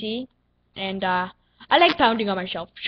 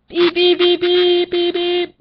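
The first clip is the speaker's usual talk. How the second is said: high, loud repeated beeps; censor beep imitation; 5 semitones higher, 5 dB louder, slower than usual. Gamer bleeping out own cursing, mock censor beeps. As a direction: imitate a bleeper in a raised monotone. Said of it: "do some random sound effects".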